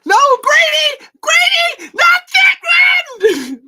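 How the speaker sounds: frantic high-pitched shouting; Tremors movie impression. Host acts out movie warning to Grady, frantic scream. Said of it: "do 'em exactly in the voice".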